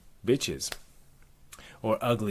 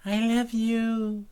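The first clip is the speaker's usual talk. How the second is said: very high, drawn-out declaration; loud, slow, high-pitched swooning; lovestruck nymph; 13 semitones higher, 5 dB louder, slower than usual. Acted. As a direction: declare love in a high sing-out voice. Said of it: "she says".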